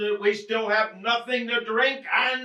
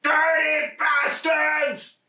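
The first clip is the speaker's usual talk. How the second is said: furious curse; 6 semitones higher, much slower than usual. Acted. Husband cursing the burglars, slow furious shout.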